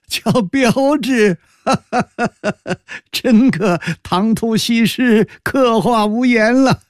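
high-pitched, laughing mockery; laughing heartily. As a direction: talk through bursts of high laughter, ridiculing. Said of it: "高士奇大笑道". (大笑着高声嘲弄)

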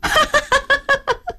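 high, wild mocking laughter. Wife laughs wildly at her husband's claim, scornful. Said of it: "狂笑起来".

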